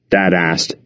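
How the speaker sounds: usual speaking voice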